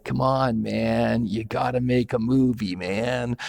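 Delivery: a hint of a slow stoner drawl, imitated; Tommy Chong drawl. Retelling a famous cellmate, a light imitation of his stoner drawl. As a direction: lightly mimic a laid-back stoner, stretching the words. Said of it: "his standard kind of drawl going".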